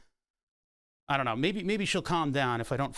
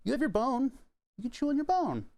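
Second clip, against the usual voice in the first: much higher sing-song voice to a puppy; high puppy talk; 11 semitones higher than usual. Real, not acted. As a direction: coo up high to the dog. Cooing at puppy.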